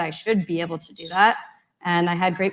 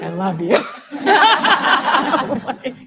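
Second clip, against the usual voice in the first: laughing; 6 semitones higher, 7 dB louder than usual. Retold or imitated. Retelling meeting her new mentor.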